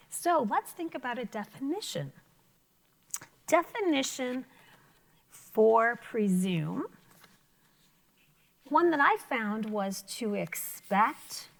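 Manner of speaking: ordinary delivery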